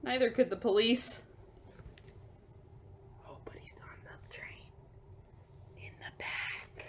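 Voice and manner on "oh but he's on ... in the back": hushed, secretive whisper. Acted. Telling the kids where the ape hides, whispered. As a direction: whisper it so Marvin isn't caught.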